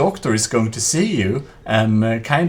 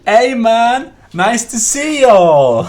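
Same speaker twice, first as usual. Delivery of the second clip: loud, slow, higher mock American accent; 10 semitones higher, 9 dB louder, slower than usual. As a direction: stretch out y'all in an American accent. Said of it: "How is this American accent?".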